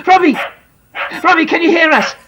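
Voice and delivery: high urgent calls of his name; pleading parents calling out. Acted. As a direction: call him back from sleep. Parents call to waking son.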